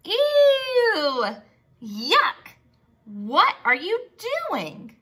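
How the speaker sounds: high squeal of disgust on the ew; grossed-out kids voice, loud; disgusted mole rats. Acted. Other mole rats mock Wilbur, high and disgusted. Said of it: "they said".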